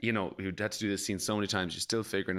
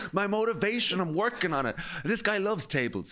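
silly actor excuse; 9 semitones higher than usual. Pretend. Pretend actor defending himself.

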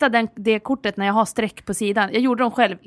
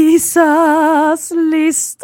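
jingle announcer; 4 semitones higher, 10 dB louder, much slower than usual. Acted. Announcing the list segment.

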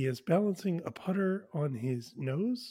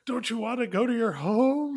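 coaxing the ball, higher and louder; talking to golf ball; 8 semitones higher, 7 dB louder than usual. Retold or imitated. Happy coaxes the golf ball into the hole, higher and louder. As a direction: plead with the ball like a pet. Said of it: "he says".

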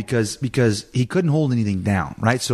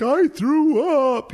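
put-on high, slow little boy confessing; a small child admitting it in a tiny high voice; boy telling mom he threw up, high and slow; little boy's voice; much slower than usual. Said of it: "he's like".

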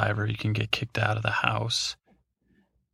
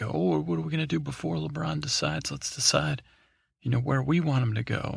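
higher-pitched, lightly voiced spider; spider thinking aloud; 5 semitones higher than usual.

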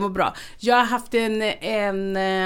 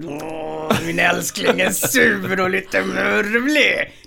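doting cooing; 3 semitones lower, much slower than usual. Retold or imitated. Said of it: "skrattar lite och så".

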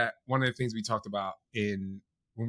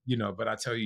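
usual speaking voice

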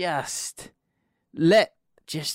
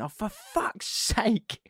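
drawn-out exasperated groan, pitched high; exasperated outburst; 6 semitones higher, much slower than usual. Retold or imitated. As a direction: let the frustration out slowly, re-enacting the moment. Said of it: "she just heard me ... I went".

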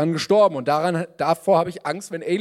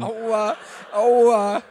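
whining; 6 semitones higher, 4 dB louder than usual. Acted. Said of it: "Es wird viel gejammert in diesem Film".

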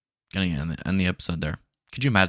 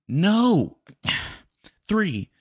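exasperated; 11 semitones higher, 5 dB louder than usual. Acted. Customer exasperated at bot.

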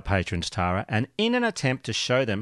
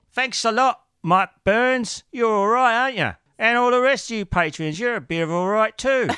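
higher Cockney Michael Caine voice; Michael Caine impression; 9 semitones higher than usual. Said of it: "thank him sounding like Michael Caine".

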